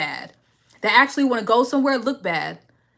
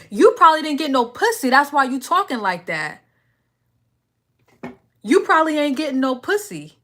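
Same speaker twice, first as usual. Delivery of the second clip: raised-voice clapback at a chat troll; heated comeback; 5 semitones higher than usual. Real, not acted. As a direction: fire it back at him. Snapping back at a viewer.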